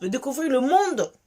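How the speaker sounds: usual speaking voice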